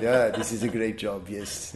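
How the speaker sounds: put-on light Israeli accent through a laugh; mild Israeli accent impression, laughing; Israeli accent imitation. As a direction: imitate your Israeli friend, amused. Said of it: "and he talked like this".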